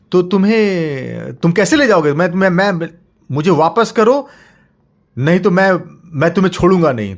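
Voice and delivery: high, loud furious threat; stammering with rage, warn him; angry threat. Acted. Father threatens monster to return child, furious.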